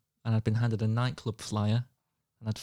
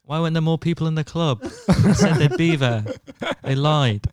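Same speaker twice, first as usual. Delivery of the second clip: put-on laughing, higher and louder drunken complaint; hammed-up tipsy self-pity through laughter; half-laughing drunk grumble; laughing drunk whine; 4 semitones higher, 6 dB louder than usual.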